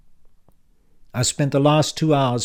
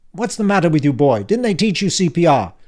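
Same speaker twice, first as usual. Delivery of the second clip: put-on louder, higher, impatient old man; brusque uncle Frank; 6 semitones higher, 8 dB louder than usual. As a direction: bark it like a testy uncle back from the dead. Frank scolds Dan.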